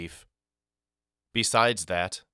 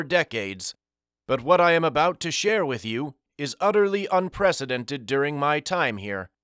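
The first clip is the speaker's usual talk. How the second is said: put-on high, airy voice of an elderly board member; breathy, lofty old gentleman speaking to children; airy old man voice; 6 semitones higher than usual. A narrator's reading. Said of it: "With an airy voice".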